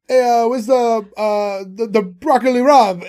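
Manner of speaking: loud, hammed-up thick Italian accent; mock an embarrassingly thick Italian accent; put-on Italian shopper, loud and laughable; fake Italian accent. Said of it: "fake Italian accent that would be".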